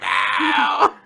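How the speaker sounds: high, loud mock scream; Latla screaming. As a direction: cry out in hammed-up horror. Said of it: "And Latla just screams".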